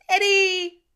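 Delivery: put-on high, loud child's cry; a small boy yelling for his dad; lost boy shouting daddy; child calling. A narrator's reading.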